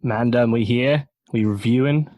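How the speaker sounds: mock London roadman slang; playful put-on London roadman; london roadman accent. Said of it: "I forget all the slang".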